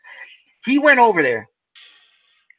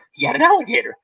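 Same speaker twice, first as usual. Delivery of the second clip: usual speaking voice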